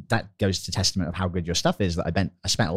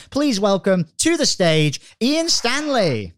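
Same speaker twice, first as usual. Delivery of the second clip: slow, higher, louder announcer build-up; stage announcer; 10 semitones higher, 5 dB louder, much slower than usual. Acted. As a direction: call him onto the stage like a showman. Host introducing guest on stage.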